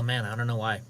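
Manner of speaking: usual speaking voice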